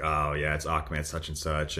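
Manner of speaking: low, subdued reluctant reply; begrudging. Retold or imitated. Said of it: "he very begrudgingly told me".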